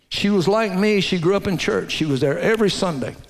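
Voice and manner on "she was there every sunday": normal talking voice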